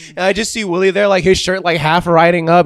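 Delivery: normal talking voice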